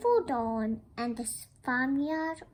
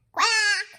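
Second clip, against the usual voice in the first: loud, high duck quack; squawked duck reply; duck's answer; 8 semitones higher, 8 dB louder than usual. Acted.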